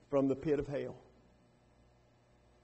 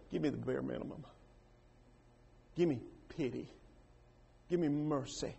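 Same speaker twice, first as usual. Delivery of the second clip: soft, low, begging; humble plea; 4 semitones lower, 10 dB quieter than usual. Retold or imitated. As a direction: ask for mercy quietly, with nothing left. Rich man's actual plea.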